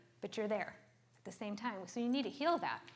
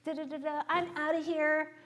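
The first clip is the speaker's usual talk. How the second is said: raised pitch, confronting the husband; mock badass confrontation, pitched up; in his face; 4 semitones higher than usual. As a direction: get up in his face and scold. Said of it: "I'm in his face".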